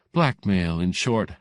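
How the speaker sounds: ordinary delivery, nothing marked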